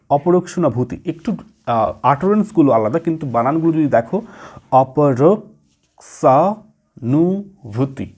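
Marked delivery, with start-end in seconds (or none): 4.7-8.1 অপরোক্ষানুভূতি read slowly, syllable by syllable; slow teacher's syllable-by-syllable spelling-out of অপরোক্ষানুভূতি; broken into syllables. Shown as a demonstration. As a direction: split অপরোক্ষানুভূতি into small parts, slowly. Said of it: "একটু ভেঙে ভেঙে পড়তে হবে".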